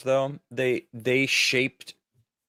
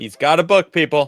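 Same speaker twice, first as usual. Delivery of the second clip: teasing announcement; 6 semitones higher, 8 dB louder than usual. Acted.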